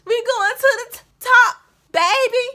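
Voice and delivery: put-on high, loud little kid's hype answer; toddler voice. Toddler daughter answering her father, high excited shout. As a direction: play the toddler shouting it back. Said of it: "And she was like".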